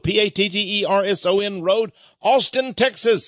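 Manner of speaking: ordinary talk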